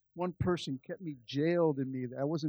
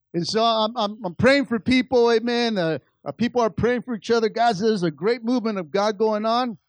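raised, louder preaching voice; higher, louder pulpit delivery; fervent preaching; 7 semitones higher, 8 dB louder than usual. Acted. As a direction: preach it with full fire, climbing higher. Preaching the altar scene at full force.

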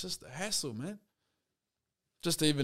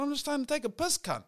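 much higher, softer exasperated plea; fed-up complaint; 8 semitones higher, 7 dB quieter than usual. Retold or imitated. Fed up with the fight while needing to piss, high exasperated. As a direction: whine it high and worn out. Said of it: "I was like".

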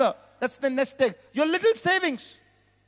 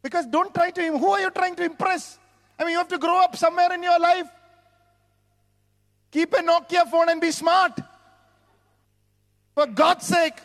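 higher, forceful preaching voice; 6 semitones higher, 4 dB louder than usual. Real, not acted. Preacher admonishing young people about spending to impress, forceful preaching. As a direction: drive the admonition home in a raised voice.

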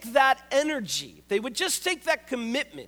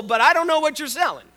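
ordinary talk

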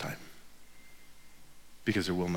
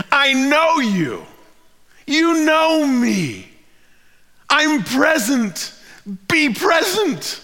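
slow, higher, gentle words of recognition; tender Jesus; 13 semitones higher, 6 dB louder, much slower than usual. Acted. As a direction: speak each short phrase slowly and lovingly. Jesus speaking to Mary.

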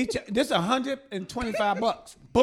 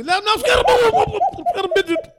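loud, high mock-frightened shout through laughter; mock pleading; 9 semitones higher, 5 dB louder, much faster than usual. Boy pleads with the preacher, a mock-terrified shout. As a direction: beg in hammed-up terror. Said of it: "The Lord gonna help you".

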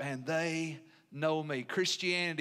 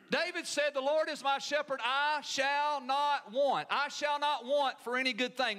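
fervent sermon climax; preaching at full force; 7 semitones higher than usual. Acted. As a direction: drive each line harder like a revival preacher. Preaching crescendo on God's provision.

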